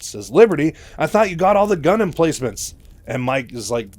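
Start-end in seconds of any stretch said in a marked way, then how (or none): 0.3-2.7 high, loud startled protest; all surprised. Retold or imitated. Raynor caught by fire at the doorway, loudly surprised. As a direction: blurt it out in acted surprise. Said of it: "Raynor, all surprised".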